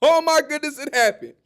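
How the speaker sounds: loud, high burst of disbelief; excited realization. Retold or imitated. At work, seeing the trade alert, loud excited gasp. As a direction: blurt it out, thrilled by the news. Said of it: "I'm like".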